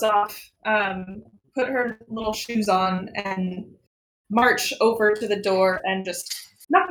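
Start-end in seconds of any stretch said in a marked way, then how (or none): none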